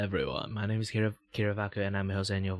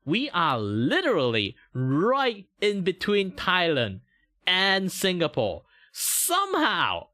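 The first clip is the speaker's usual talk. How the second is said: exaggerated disbelief; 8 semitones higher, 4 dB louder, slower than usual. Real, not acted. Exasperated point about Malaysia's location.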